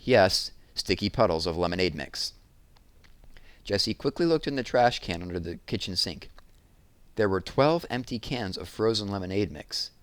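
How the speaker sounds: ordinary delivery, nothing marked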